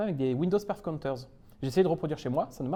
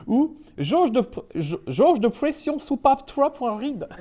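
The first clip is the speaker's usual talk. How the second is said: high, drawn-out mock English accent; hammed-up English accent on French; 6 semitones higher, 4 dB louder, slower than usual. Shown as a demonstration. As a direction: stumble slowly through French words with an English accent. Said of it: "j'essaye de prendre l'accent anglais".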